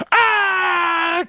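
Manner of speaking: high, loud, far-off cry of a crewmate blown apart; mock death scream. Buddy blown apart by a cannonball, imitated dying scream. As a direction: yell as if hit by a cannonball. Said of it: "one of your buddies goes".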